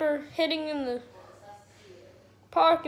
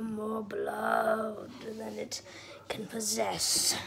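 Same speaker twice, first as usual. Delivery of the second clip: nature documentary; 4 semitones lower, 5 dB quieter than usual. Pretend. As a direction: whisper it like a nature host. Documentary narration resumes.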